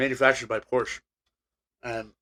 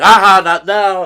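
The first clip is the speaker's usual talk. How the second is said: loud, slow, higher teasing dog voice; voicing the dog; 6 semitones higher, 12 dB louder, slower than usual. Dog on the roof teasing his owner, slow loud taunting voice. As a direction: give it as the smug dog dodging you. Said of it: "He would get smart and be like".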